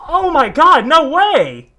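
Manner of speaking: normal talking voice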